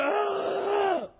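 long high "aah"; hammed-up drawn-out wail; mock exasperated cry. Church visitors giving up, mock exasperated cry.

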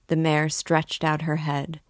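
usual speaking voice

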